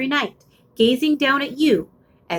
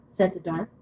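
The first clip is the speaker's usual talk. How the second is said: plain reading voice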